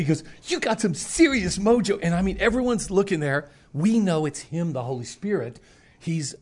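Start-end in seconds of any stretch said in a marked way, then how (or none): 0.5-2.0 high, loud amazed repeat; excited praise. Retold or imitated. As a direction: say it again, still amazed.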